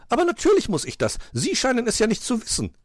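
high, loud comeback; retorting magpie. A narrator's reading. Magpie gives it back to the fox, retorting. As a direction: retort quickly at a high pitch. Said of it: "gab sie zurück".

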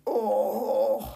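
long acted groan. A narrator's reading. Fox rolling onto his back, groaning. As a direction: groan like an overfed patient. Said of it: "drehte sich ächzend auf den Rücken".

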